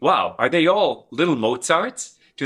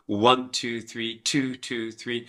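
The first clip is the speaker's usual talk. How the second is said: counting the beat, fading softer; counting the rhythm; 3 semitones lower, 5 dB quieter, slower than usual. Shown as a demonstration. Teacher counting a rhythm, fading out. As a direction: count in time, trailing off. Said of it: "We have rhythm".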